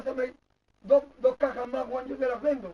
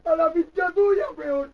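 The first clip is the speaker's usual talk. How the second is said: loud, higher, drawn-out retort; angry outburst; 4 semitones higher, 8 dB louder, much slower than usual. Real, not acted. Father snapping at child in a quarrel, angry.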